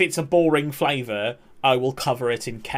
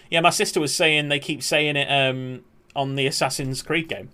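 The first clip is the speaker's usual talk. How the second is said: usual speaking voice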